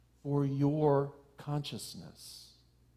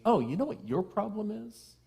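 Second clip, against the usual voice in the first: mock know-it-all; 8 semitones higher than usual. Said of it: "we can easily see".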